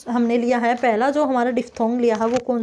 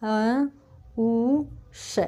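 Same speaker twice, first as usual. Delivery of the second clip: slow sounding out; 4 dB quieter, much slower than usual. Shown as a demonstration. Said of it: "तो कैसे लिखेंगे".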